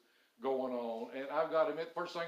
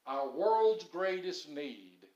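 slow emphasis; 6 dB louder, much slower than usual. Real, not acted. Preacher slows for emphasis.

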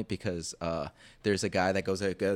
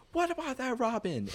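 mock confusion; 3 dB quieter than usual. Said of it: "And he was like".

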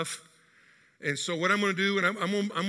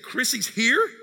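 higher, louder, stunned question; can't believe she's home; a father caught off guard, surprised; stunned disbelief; 5 semitones higher, 5 dB louder, slower than usual. Retold or imitated.

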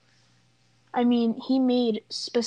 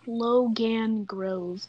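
slow and drawn out; much slower than usual. Real, not acted. Reading name off search.

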